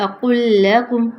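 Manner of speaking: slow Tajweed recitation, heavy qaf, thin lam; Quranic recitation. Shown as a demonstration. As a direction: recite اقل لکم with a full qaf and a light lam. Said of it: "لام کو باریک کر دیجیے گا".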